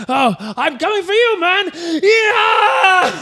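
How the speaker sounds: high-pitched mock battle cry; furious, hammed-up. D'Artagnan going after his enemy out the window, mock fury. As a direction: build to a squealed, over-the-top war whoop.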